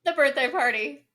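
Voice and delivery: ordinary talk, nothing marked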